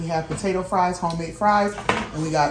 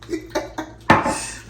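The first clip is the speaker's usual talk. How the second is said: unvoiced laugh. Real, not acted. Laugh alone.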